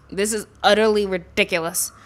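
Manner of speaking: ordinary talk, nothing marked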